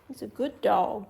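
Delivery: ordinary talk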